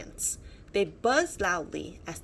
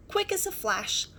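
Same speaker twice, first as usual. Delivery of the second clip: ordinary delivery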